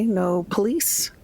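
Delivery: ordinary talk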